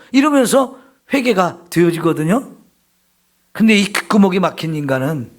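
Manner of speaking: ordinary talk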